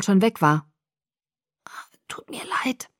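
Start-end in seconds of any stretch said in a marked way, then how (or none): 2.1-2.9 soft, whispery, higher croak; murmured. A narrator's reading. Bella apologising to her rescuer, faint hoarse murmur. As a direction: barely get the apology out. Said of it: "murmelte ich".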